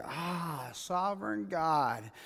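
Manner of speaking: slow, soft sigh to God; hushed, drawn-out imitation of surrender; quiet realization. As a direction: let it out slowly and softly, as if it finally sinks in. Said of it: "after it's all said and say".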